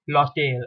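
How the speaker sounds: "L'hôtel" with the H dropped, lo-TEL; French "L'hôtel", no H sound at all; silent French H. Shown as a demonstration. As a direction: run the L straight into the O in "L'hôtel". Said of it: "you do not pronounce it".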